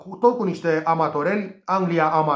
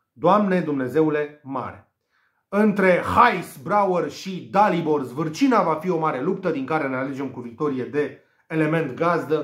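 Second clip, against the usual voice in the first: ordinary talk, nothing marked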